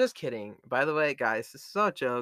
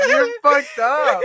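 high, slow laughing exclamation; 12 dB louder, slower than usual. Real, not acted. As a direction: laugh it out in disbelief. Laughing disbelief at friend.

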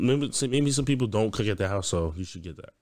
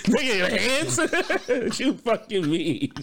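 high, incredulous laughing outburst; laughing disbelief; 10 semitones higher than usual. Real, not acted. Mocking the meatball machine excuse to his co-host, laughing in disbelief. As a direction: crack up while questioning it.

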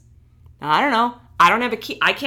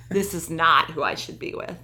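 ordinary delivery

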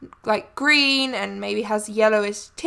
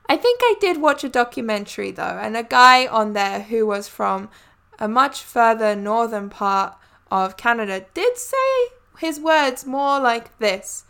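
ordinary talk

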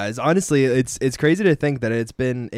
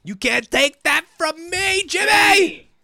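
angry yell; 3 dB louder, much slower than usual. Retold or imitated. Acted angry yell at diners.

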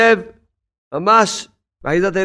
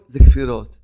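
a stern low זה כפירות; low punched refrain; 7 semitones lower, 4 dB louder than usual. Acted. Preacher rebuking doubts, low stern verdict. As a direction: drop low, stress זה and say it hard.

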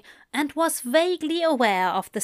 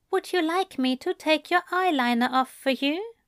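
gentle Aziraphale voice; 6 semitones higher than usual. Acted. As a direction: offer it kindly like a doting angel. Aziraphale speaking to Crowley.

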